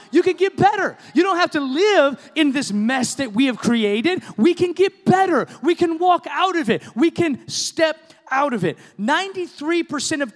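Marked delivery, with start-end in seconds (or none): 0.1-8.8 loud, high, rallying cry of hope; rousing preaching. Real, not acted. Preacher rousing the church toward change, loud and high. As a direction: lift them up with full force.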